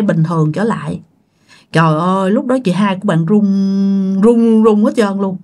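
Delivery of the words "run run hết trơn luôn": plain reading voice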